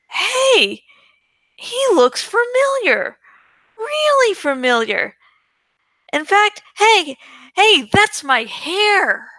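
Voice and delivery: high, bemused double-take voice; puzzled inner voice. Retold or imitated. Narrator mimicking his own baffled thoughts, high.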